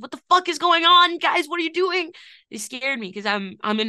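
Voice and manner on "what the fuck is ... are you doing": louder, higher cry of disbelief; exasperated outburst. Retold or imitated. Fan yelling at the band's muddled teaser clip, exasperated. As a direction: throw it at the band like you can't believe it. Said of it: "And I was like".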